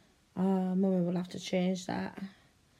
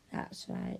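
whisper; 6 dB quieter than usual. Acted. Soft approval in role.

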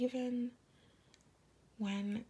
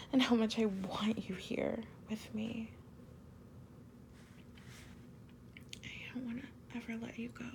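ASMR whisper; 2 semitones higher than usual. Acted. Girlfriend confessing love to her partner, whispered. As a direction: breathe each word like a secret to a lover.

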